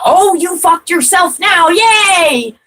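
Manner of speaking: high, loud sneering gloat; mocking taunt. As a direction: gloat like you've won, shrill with glee.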